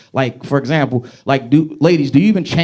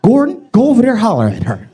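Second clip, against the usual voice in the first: lightly voiced pushy grandma; a hint of an old grandma urging; grandmother voice; 6 semitones higher than usual. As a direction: nudge him like a meddling grandmother. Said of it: "She says".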